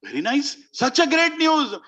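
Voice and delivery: high, jubilant cheer, drawn out; very happy. Acted. Kaikeyi hears Ram will be crowned, very happy. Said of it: "She was very happy".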